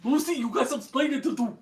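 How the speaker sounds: put-on Cuban-accented scolding, playfully. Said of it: "with [name] ... in New York".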